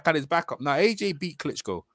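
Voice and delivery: ordinary delivery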